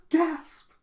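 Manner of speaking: very high, loud comic gasp; gasp, then squeal the word in mock horror; scandalized gasp. Boy hears talk of marriage, hammed-up shock.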